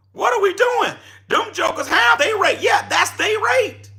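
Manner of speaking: excited hollering. Real, not acted. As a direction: get worked up and holler at them. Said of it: "Y'all see I'm getting excited".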